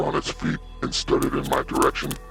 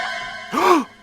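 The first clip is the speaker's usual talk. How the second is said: sharp, high gasp of shock; 3 dB louder than usual. A narrator's reading. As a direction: suck in a startled breath. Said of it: "recoiled in shock".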